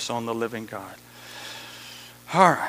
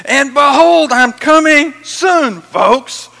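high, very loud, drawn-out proclamation; excited shout; 7 semitones higher, 11 dB louder, much slower than usual. Acted. Preacher voicing Jesus who just can't stand it anymore, excited shout. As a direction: burst out with it, slow and booming. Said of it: "he just jumps up and says".

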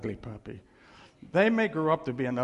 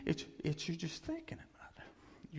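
whisper; 4 semitones higher, 9 dB quieter than usual. Acted. Secret thought, hushed aside.

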